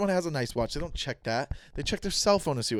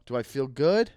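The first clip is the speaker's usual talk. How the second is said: ordinary talk, nothing marked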